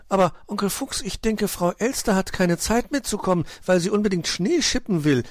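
high-pitched, earnest little fellow; childlike voice, pitched well up; eager young nephew. Acted. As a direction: pipe up with an innocent explanation. Nephew explains to uncle.

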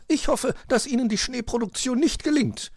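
high-pitched lady magpie; contrary magpie. A narrator's reading. Magpie hopes their snowmaking fails, contrary. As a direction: say it pertly, pitched right up. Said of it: "sagte sie".

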